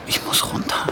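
hurried breathy whisper to himself. Acted. Alone in a hurry, whispering to himself. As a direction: whisper it, no voice at all. Hurried aside before going down.

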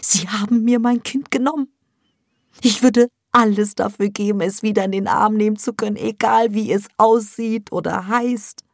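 high, loud, anguished mother; grieving mother. Acted. Mother tells Sevda her child was taken, high and anguished. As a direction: cry out the loss.

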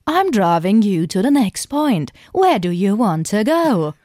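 slow, stiff robot voice. Pretend. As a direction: speak like a humanoid robot. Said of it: "hab ich einfach die ganze Zeit so gesagt".

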